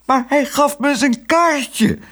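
very high, drawn-out whine; desperate wail. A narrator's reading. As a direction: wail it slowly in helpless protest. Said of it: "jammerde de zuster wanhopig".

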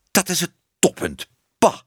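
high, indignant outburst; outraged cry. A narrator's reading. Man catching her washing like a cat, outraged cry. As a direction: exclaim it, mock-yelling in outrage. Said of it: "schreeuwde Tibbe".

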